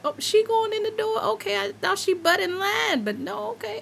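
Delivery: high-pitched comic grumbling at a line-cutter; hammed-up high-voiced pique; mock indignation. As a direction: squeak out playful suspicion, then give in. Acting out watching someone cut in line.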